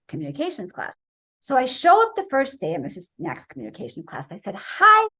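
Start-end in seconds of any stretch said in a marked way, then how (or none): 4.5-5.1 bright hello. Retold or imitated. Eager greeting to teacher.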